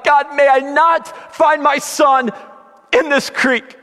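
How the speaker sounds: high, strained plea to God; pleading. Retold or imitated. Father begging God not to find son in creek, pleading. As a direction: beg desperately, leaning hard on each phrase. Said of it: "pleading with God".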